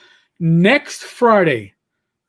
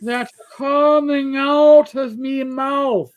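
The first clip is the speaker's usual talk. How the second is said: very slow, higher Irish brogue; slow Irish father; 7 semitones higher, much slower than usual. Retold or imitated. Imitating the Irish father talking down to him, very slow Irish brogue. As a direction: drag each word out like a thick-brogued old Irishman. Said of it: "Talked so slow".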